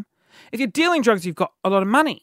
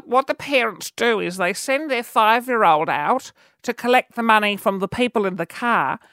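put-on elderly lady neighbour; 3 semitones higher than usual. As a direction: gossip like a sweet old lady dropping shocking news. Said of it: "sorry, let me do the voice".

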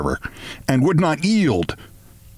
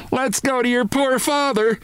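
frantic mother; 10 semitones higher than usual. A narrator's reading. Mother voiced in panic.

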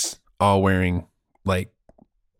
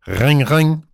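phone ring imitation; 4 dB louder than usual. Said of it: "the next time I call him".

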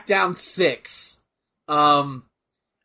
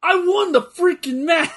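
high, shouting triumphant claim; excited wrestler voice; 10 semitones higher than usual. Pretend. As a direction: crow about winning. Voicing Kurt Angle on screen.

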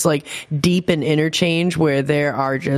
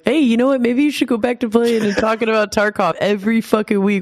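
lightly mimicking pushy pals, higher; mock nagging friends; 6 semitones higher, faster than usual. Player imitating guys who want Tarkov back, mock nagging. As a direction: imitate the guys pestering you in a raised pitch. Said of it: "All these guys, they're like".

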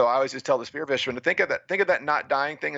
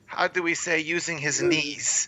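higher, mock-gushing commentator; gushing announcer; 5 semitones higher than usual. Mocking the TV commentary of his race, a higher gushing announcer. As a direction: lightly ham up a broadcaster raving over the star. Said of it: "So the announcer's like".

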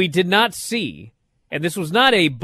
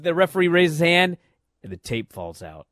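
normal talking voice

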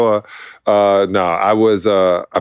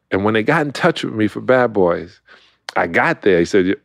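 ordinary talk

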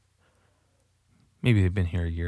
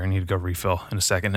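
ordinary talk, nothing marked

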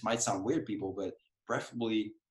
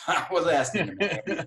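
laughing through the words, higher; 8 semitones higher, 5 dB louder than usual. Real, not acted. Telling a podcast host, laughing. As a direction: giggle it out. Laughing while recalling asking.

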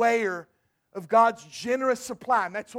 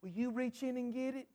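put-on a faint, much softer little boy's question; 13 dB quieter than usual. Shy boy faintly asks the grocer to fetch candy, much softer. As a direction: barely voice it, like a shy child. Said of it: "he said very faintly".

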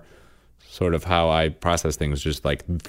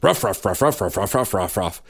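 dog barking. Retold or imitated. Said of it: "I do all the lines".

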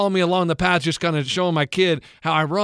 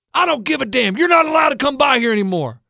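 angry yell; 6 semitones higher, 3 dB louder than usual. Retold or imitated. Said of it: "And he goes".

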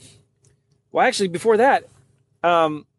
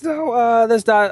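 flustered protest; 8 semitones higher, slower than usual. Retold or imitated. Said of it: "wetting my pants".

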